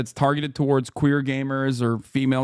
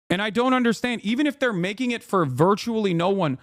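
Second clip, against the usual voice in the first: ordinary delivery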